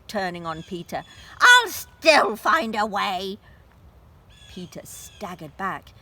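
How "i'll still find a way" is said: loud snarling threat; witch's threat. Acted. The witch turns on Peter with a loud threat. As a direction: spit it out full of spite.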